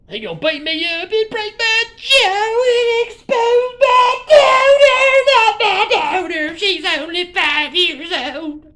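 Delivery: high, loud hammed-up wail, slow and stretched; fake-sob the plea like a soap opera; mock wailing. Vlogger mock-wailing about his daughter being exposed.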